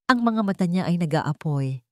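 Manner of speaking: plain reading voice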